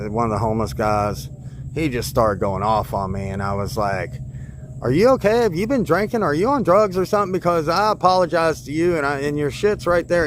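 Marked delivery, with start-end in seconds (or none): 4.8-10.3 heated retort. Retold or imitated. Narrator confronting the man shouting at him, heated and higher. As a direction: push back hard at him. Arguing back at yelling man.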